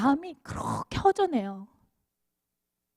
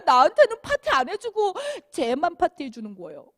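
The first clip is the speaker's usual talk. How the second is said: higher, louder whiny protest; complaining older son; 6 semitones higher, 4 dB louder than usual. Retold or imitated. Older son complaining to his father about the party, whiny. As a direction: press the father, hurt and indignant. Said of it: "막 따지는 거예요".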